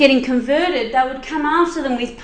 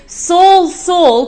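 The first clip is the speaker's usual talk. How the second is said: loud, high call of Saul's name; divine call; 5 semitones higher, 6 dB louder than usual. Acted. Jesus calling down to Saul on the road. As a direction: call out from heaven, ringing.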